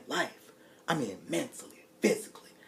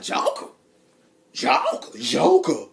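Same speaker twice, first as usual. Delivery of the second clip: rising, loud disbelief; incredulous; 11 semitones higher, 8 dB louder, slower than usual. Retold or imitated. Loud disbelief at Harley's excuse. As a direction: repeat it in growing disbelief.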